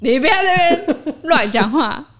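high, loud mock outrage; playful scolding. Real, not acted. Scolding a co-host who teases about the neighbour's son, playfully indignant. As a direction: shut the teasing down loudly, half laughing.

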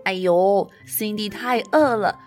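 ordinary delivery, nothing marked

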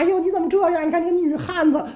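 put-on high, teasing girlish voice; giggling palace maid. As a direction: play a giggly court maid mocking a friend. (宫女嬉笑打趣，声调高)

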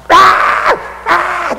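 sharp high mock shriek; short piercing yells; combative child's scream. Shown as a demonstration. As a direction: scream like a child putting up a fight. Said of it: "这样，这样叫法".

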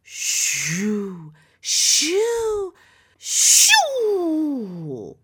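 rising whoosh of wind. Acted. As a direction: blow like a gust. Wind sound effect.

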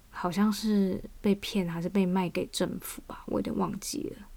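unsure, hushed whisper; whispered aside. Real, not acted. Unsure aside about lost property.

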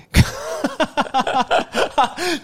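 rising giggle, high; delighted high laugh. Real, not acted. Guide laughing at the cute little girl.

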